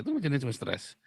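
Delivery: ordinary talk, nothing marked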